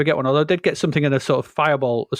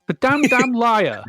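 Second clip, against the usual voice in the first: mock outrage; 8 semitones higher, slower than usual.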